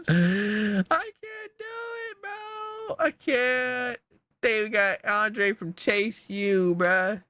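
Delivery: high-pitched, slow despairing cry; exasperated wail. Real, not acted. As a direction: wail it out like you're breaking. Overwhelmed, can't choose any more.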